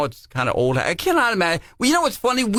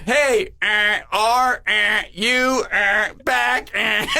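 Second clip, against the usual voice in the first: words alternating with busy-tone beeps, pitched up; talking between beeps; 5 semitones higher, much slower than usual. Retold or imitated. Kids checking back on the line, talking between beeps. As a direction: drop one word at a time between imitated beeps. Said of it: "then we go back and".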